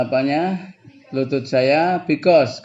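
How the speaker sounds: ordinary talk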